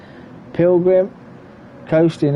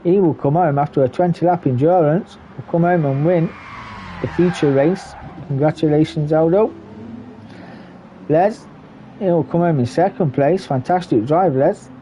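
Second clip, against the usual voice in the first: lively, raised play-by-play call of the finish; excited race commentary; 3 semitones higher than usual. Acted. Race caster to viewers, calling the finish excitedly. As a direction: call the cars home with building excitement.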